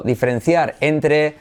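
usual speaking voice

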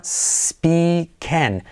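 "speaking" drawn out as "spee-ken"; syllable-by-syllable demonstration. Shown as a demonstration. Teacher breaking it down for learners, "speaking" slowly as "spee-ken". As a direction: stretch "speaking" in two parts, ending in "ken". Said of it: "letra semiclave líquida".

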